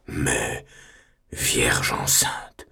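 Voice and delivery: low, slow hushed whisper; scandalized whisper. Acted. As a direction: breathe Vierge enceinte as if shameful. Superior whispers the words.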